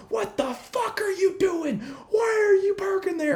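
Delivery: high squeaky mock yell of an angry stranger; mock screaming man. Stranger berating a teen in parking lot, high mock yell. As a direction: ham up a shrieking stranger. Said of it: "starts screaming and yelling at me".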